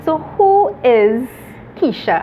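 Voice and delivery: normal talking voice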